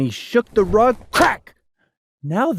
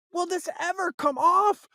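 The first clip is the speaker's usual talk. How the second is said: put-on worried child; 11 semitones higher than usual. Boy worries about ring.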